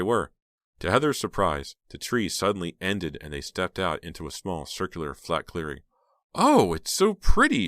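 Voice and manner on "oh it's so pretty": much higher and louder, slow with delight; delighted exclaim. A narrator's reading. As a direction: gasp at the pretty clearing. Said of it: "she exclaimed".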